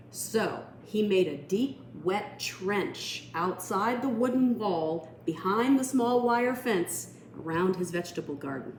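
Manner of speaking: ordinary delivery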